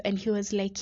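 ordinary talk, nothing marked

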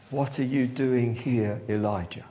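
low, soft and gentle, God speaking quietly; low hushed divine voice; small still voice. Acted. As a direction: let the question come as a hush. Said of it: "a still, small voice".

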